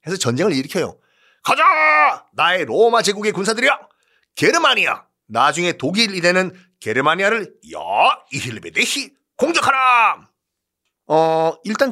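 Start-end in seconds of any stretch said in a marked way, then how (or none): 1.4-10.3 rally the legions to war; rallying battle cry. Acted. Emperor rallying troops to war.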